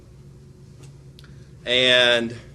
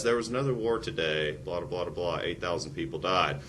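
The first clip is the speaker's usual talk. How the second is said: bored flat newsreader; 5 semitones lower, 5 dB quieter than usual. Retold or imitated. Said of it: "no one ever says".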